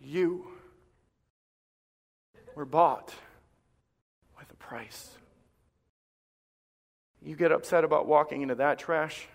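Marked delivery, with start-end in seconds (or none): 0.0-5.2 drops to a whisper by the last words; quiet, solemn voice of God; hushed whisper. Acted. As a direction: speak God's words softly, fading to breath. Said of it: "And God looks at you and says".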